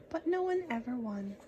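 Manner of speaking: ordinary delivery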